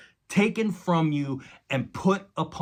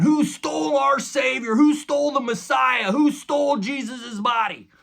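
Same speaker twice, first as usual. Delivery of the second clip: much higher, louder alarmed cries; shocked women; 11 semitones higher, 6 dB louder than usual. Retold or imitated. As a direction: cry out as the stunned women at the tomb. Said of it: "are shocked to find Jesus gone".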